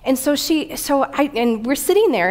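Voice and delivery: ordinary talk, nothing marked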